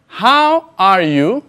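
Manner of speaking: each word spaced out slowly for the learner; slow model sentence. Shown as a demonstration. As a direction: say it slowly and clearly, like a teacher modelling. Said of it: "presta mucha atención". (pregunta modelo, lenta y clara)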